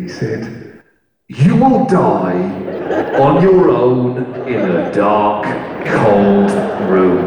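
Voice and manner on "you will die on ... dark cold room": slow, loud, ominous toy voice; slow menacing teddy bear message; creepy teddy bear. Retold or imitated. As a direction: intone a doom prophecy like a talking bear. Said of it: "This is what that bear said back to me".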